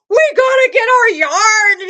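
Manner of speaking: high, loud excited yell; giddy group yell; excited shout. Retold or imitated. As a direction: shout it like you can't wait to grab the yarn. Group rushing to get yarn.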